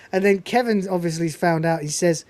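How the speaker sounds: ordinary talk, nothing marked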